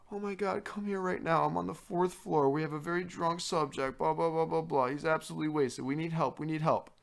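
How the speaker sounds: high, urgent phone call; hammed-up alarm calling for backup; RA frantically phoning for backup; mock panicked RA.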